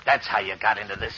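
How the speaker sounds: ordinary talk, nothing marked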